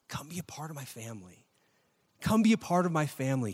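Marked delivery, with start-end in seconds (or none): 0.1-1.4 softer, tender invitation in God's voice. Acted. Preacher voicing God to the congregation, soft and tender. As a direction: coax them in gently.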